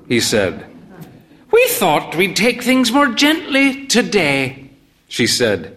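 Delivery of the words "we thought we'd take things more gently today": put-on old mother voice. A narrator's reading.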